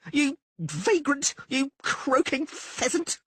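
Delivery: high, slow, loud scream. Acted. Blonde woman screams at Dandelion from the balcony. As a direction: drag out the insult.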